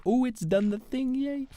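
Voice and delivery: put-on high, slow, childlike delight; mock happy cheer. Gamer mimicking their own joy at finished crops. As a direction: squeal it like a delighted kid.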